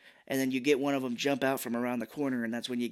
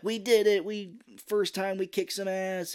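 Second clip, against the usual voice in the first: proud cheer; 7 semitones higher than usual. Retold or imitated. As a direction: celebrate the first win. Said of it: "they're like".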